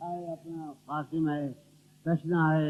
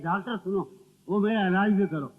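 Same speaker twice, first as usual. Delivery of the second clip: ordinary talk, nothing marked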